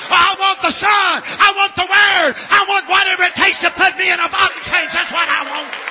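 loud, high, shouted preaching; fiery revival preaching; preaching at full force. Acted. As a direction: preach it hard to the congregation.

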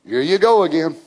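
ordinary delivery

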